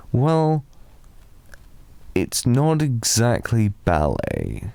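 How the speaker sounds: ordinary delivery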